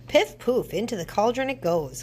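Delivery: high, quick spell cry; excited witch spell. A narrator's reading. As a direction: toss it in with glee. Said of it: "cried Naggy Witch".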